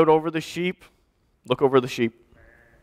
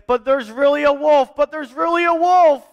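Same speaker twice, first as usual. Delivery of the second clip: high, insistent plea; pleading; 10 semitones higher, 4 dB louder than usual. A narrator's reading. Boy begging disbelieving people about the real wolf, pleading. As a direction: beg to be believed, voice raised high. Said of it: "he pleaded and he pleaded".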